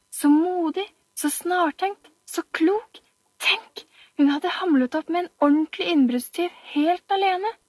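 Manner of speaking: high, admiring schoolyard chatter; high, gushing children. Acted. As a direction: gush over her like a hero.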